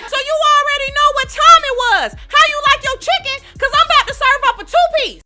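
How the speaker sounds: high, loud threatening hype; hyped fight shout. Acted. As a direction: shout it, ready to throw hands. Narrator about to fight.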